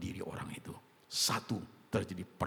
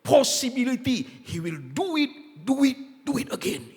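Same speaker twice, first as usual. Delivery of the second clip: emphatic shout; 14 dB louder, slower than usual. Acted. Preacher mimics repeat offender.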